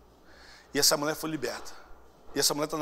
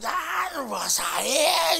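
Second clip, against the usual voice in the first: high, drawn-out demonic wail; possessed woman; 9 semitones higher, much slower than usual. Retold or imitated. Possessed woman resisting the prayer, demonic cry. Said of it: "a mulher tava lá endemoniada".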